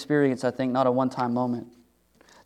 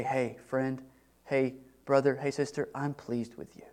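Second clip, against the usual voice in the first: soft tender words; 9 dB quieter than usual. Acted. Someone voiced speaking approval softly.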